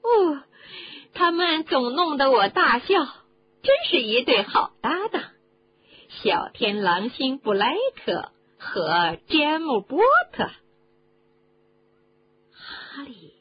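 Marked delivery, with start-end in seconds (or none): 0.0-10.5 high, loud and lively character voice; animated storybook character. A narrator's reading. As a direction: gush over an amusing pair in a raised voice.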